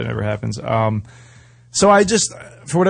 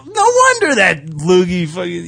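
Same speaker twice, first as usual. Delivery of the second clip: mock shocked realization; 12 semitones higher, slower than usual. Wrestler realizing he spat on a blind kid, shocked high exclaim. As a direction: act out being stunned the kid was blind. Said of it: "I'm like".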